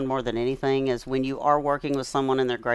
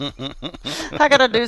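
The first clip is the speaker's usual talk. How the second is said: laughing; 9 semitones higher, 3 dB louder, much slower than usual. Retold or imitated. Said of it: "I said".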